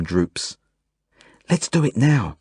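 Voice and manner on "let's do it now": put-on higher, eager little girl; excited. A narrator's reading. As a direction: blurt it out with excitement. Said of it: "says Zelda excitedly".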